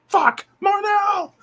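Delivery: high, slow drawn-out shout; puppet shout. Retold or imitated. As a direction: yell the name out long and high.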